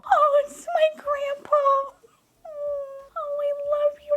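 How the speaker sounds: very high, tearful squeak; adoring coo. Real, not acted. Collector greeting the grandpa figure, tearful adoring coo. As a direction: coo it high, close to happy tears.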